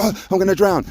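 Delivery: high, hammed-up panicky squeal; mock panic. A scared swimmer crying out to his mentor, mock panic. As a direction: play the frightened learner who thinks he'll sink.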